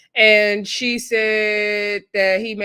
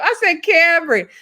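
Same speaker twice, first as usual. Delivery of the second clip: high, loud exclaim of his name; a stunned, scandalized "Cameron"; shocked call-out; 9 semitones higher, 7 dB louder than usual. Retold or imitated. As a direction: cry out his name in disbelief.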